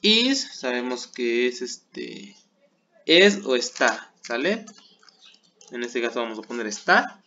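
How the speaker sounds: usual speaking voice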